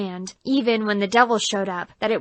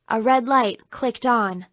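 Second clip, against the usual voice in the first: ordinary delivery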